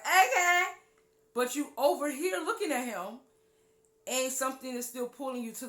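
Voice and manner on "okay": high, loud laughing okay; shrugging giggle of acceptance; amused okay. Retold or imitated. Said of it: "You just like".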